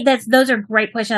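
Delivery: normal talking voice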